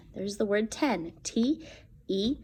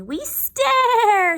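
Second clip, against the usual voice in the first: high, louder little zombie voices; 7 dB louder than usual. Acted. Little zombies answering mother, high and eerie. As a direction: stretch it high like tiny zombies.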